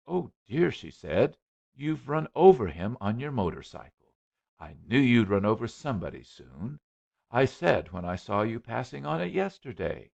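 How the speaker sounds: alarmed scolding mother; fussy mother. Acted. Mother voiced in story.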